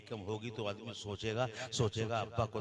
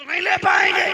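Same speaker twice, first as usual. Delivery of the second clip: booming high shouted sermon line; fiery preaching; 20 dB louder than usual. Acted. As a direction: thunder it at the crowd.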